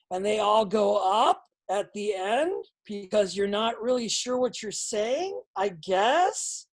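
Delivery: every phrase ending rising like a question; slow, unsure, lines lifting at the ends; up talk demo. Shown as a demonstration. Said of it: "You do a thing called up talk".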